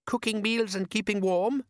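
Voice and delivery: put-on eager young son hoping; hopeful boy. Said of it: "said Les hopefully".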